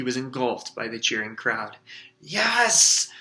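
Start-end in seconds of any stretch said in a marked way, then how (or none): 2.2-3.1 high, loud triumphant yell. A narrator's reading. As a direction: scream with victory. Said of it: "Ron yelled".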